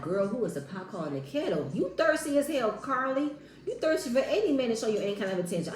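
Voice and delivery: ordinary talk, nothing marked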